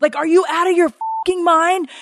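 high, strained angry yell at the gate agent; furious mother tearing into the gate agent, pitch way up; retold screaming. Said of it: "So I am screaming at this gentleman".

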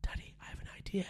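put-on whispering a secret plan like a little boy; hushed conspiratorial whisper; child's whisper. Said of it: "he'll whisper me".

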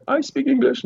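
high-pitched imitation of someone answering; retold reply piped up from across the room; voice from the crowd. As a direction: mimic a stranger calling back, high.

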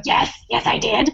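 breathy eager yes. Retold or imitated. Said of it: "And I go".

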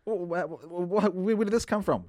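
high, stammering disbelief; stammering surprise. Real, not acted. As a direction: sputter it out in shock. Stunned reaction.

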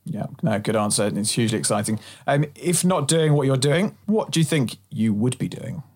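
normal talking voice

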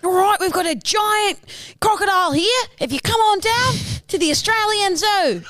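high, excitable Aussie wildlife host; hammed-up Australian zoo showman; Steve Irwin. Contestant starts an impression of an icon, excitable Aussie showman. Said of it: "Give me more".

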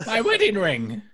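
put-on high, sweet old lady voice; elderly singsong. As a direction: coo it like a kindly granny. GM voices old clerk Mallory.

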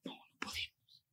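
slow, barely audible whisper; fearful whisper. Retold or imitated. Frightened girl answering that they must not tell, whispering. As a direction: re-enact shrinking back from telling. Afraid of telling what happened.

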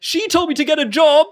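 high, loud whining thug with a laugh; whiny guard. Acted. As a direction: whine like a scolded boy.

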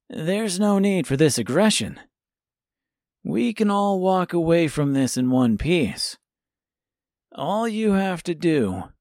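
put-on a calm boy laying out terms; confident boy voice. A narrator's reading. Tip bargains calmly.

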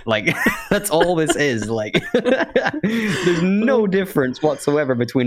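high giggly words through laughter; slow, laughing it out; laughing while talking. Real, not acted. Laughing at Michael Caine's failed accent.

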